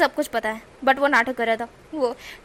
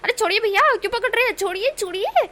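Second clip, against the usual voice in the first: high, loud protest; 9 semitones higher, 4 dB louder than usual. Acted. Wing being grabbed, high loud protest. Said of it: "wing को तो कहते हैं".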